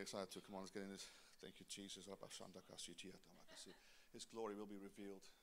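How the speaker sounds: soft, whispered prayer to the Lord; hushed prayer. Acted. Pastor praying softly over the congregation, hushed. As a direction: pray quietly, almost under the breath.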